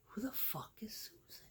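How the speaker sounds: whispered, bewildered question; a stunned whisper; incredulous whisper. Retold or imitated. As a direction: hush it in disbelief. Own baffled reaction.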